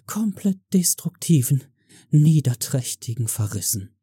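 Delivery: high, breathy pleading fan voice; breathless breathy whine. Pretend. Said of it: "höre ich euch atemlos hauchen".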